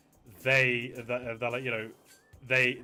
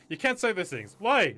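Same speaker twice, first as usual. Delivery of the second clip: lightly acted puppet routine, higher and louder; Ahmed; 7 semitones higher, 6 dB louder than usual. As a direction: quote a comic back-and-forth with a loud puzzled retort. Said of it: "What is mine of, of, like, Ahmed?".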